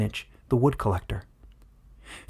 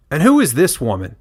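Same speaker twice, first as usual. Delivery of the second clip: loud, higher challenging villager; suspicious villager; 4 semitones higher, 9 dB louder than usual. Acted. Wood collector demanding who the stranger is, loud and suspicious. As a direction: demand answers from the elders.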